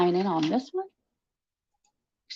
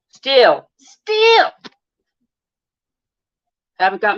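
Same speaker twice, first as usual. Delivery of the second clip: mock rant; 3 semitones higher, 9 dB louder than usual. Streamer ranting at a tarot reader for chat, loud and campy. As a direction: tell the fake psychic off, campy and loud.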